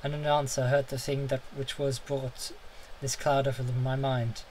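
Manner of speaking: usual speaking voice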